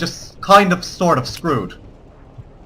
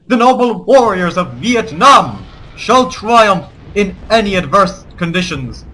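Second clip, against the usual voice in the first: slow, ringing mock-heroic oration; rally the troops, weighing every phrase; rousing war speech; 3 semitones higher, slower than usual. Mock rallying speech as Viet Cong.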